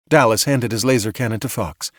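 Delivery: ordinary delivery, nothing marked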